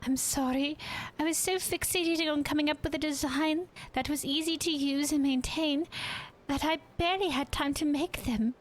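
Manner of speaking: high-pitched, apologetic character voice; apologetic game character. Acted. Crafter explaining the small batch to the boss, apologetic.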